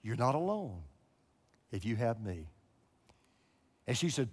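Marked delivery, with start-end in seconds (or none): 0.0-2.5 low, softer, lightly voiced divine call; a hint of a hushed heavenly voice; voice of God. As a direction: speak as a quiet inner voice, barely dramatized. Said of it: "that voice kept coming back".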